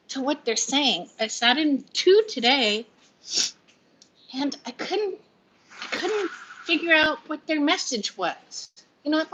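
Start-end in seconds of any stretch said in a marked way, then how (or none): 4.3-8.7 pouty cry. Real, not acted. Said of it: "my little pouty cry".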